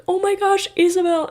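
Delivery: high, loud squeal of her name; excited squeal. Retold or imitated. Schoolmate spotting her in line, loud high squeal.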